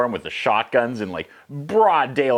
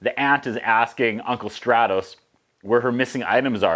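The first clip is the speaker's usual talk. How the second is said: normal talking voice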